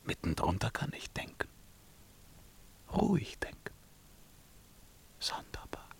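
hushed whisper to himself; king whispering as he remembers the broken crown; musing whisper. Acted. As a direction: whisper the thought as it dawns.

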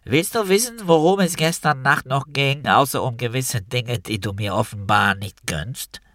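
a nose-clip trumpet of a voice, taunting; trumpety soprano. A narrator's reading. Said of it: "Terrorsopran ... etwas Trompetiges".

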